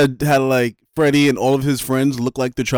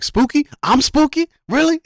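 mock offended punk; 12 semitones higher than usual. Voicing offended punk character.

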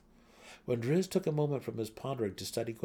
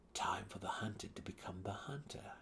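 purred whisper; 8 dB quieter than usual. A narrator's reading. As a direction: purr it in a whisper. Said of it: "he purred to the great cat".

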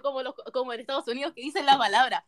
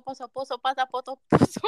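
repetición rápida; 3 semitones lower than usual. Real, not acted. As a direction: repeat it quickly in one run. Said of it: "quería que dijeran rápido". (trabalenguas rápido, grave y bajito)

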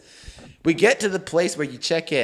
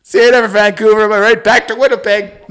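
mock cheery showman; 5 semitones higher, 7 dB louder than usual. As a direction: ham up a smug send-off. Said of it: "He's like".

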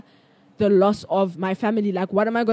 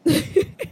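awkward laugh; 7 semitones higher than usual. Real, not acted. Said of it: "I'm not laughing".

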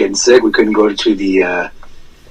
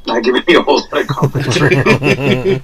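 laughing while talking; 5 semitones higher than usual. Real, not acted. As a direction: say it through a laugh. Teased, laughing reply.

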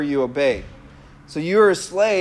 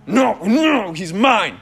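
mock demonic possessiveness; possessed demon voice; 3 semitones higher, slower than usual. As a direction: play a demon claiming its victim, hammed up. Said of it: "starts talking like this".

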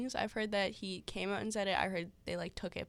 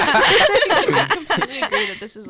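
loud laugh into speech; 11 semitones higher, 20 dB louder, slower than usual. Real, not acted. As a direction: talk through a big laugh. Still laughing as she starts.

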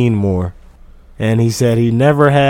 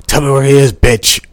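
mock interrogation; 2 semitones higher, 6 dB louder than usual. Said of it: "Slap the shit out of that girl".

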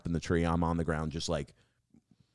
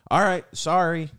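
high, louder placating voice; mock appeasing apology; 11 semitones higher, 7 dB louder than usual. Business owner placating an angry customer, high-pitched apology. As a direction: apologise in a raised, put-on voice.

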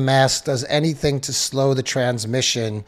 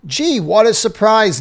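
sarcastic; 6 semitones higher, 4 dB louder than usual. Real, not acted. Sarcastic reaction to mask news.

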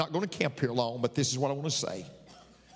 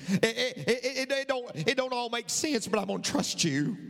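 higher, stammering with fervor; impassioned prayer; 10 semitones higher than usual. Acted. Pastor voicing a father's desperate trust in God, fervent. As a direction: pour it out to God, overcome.

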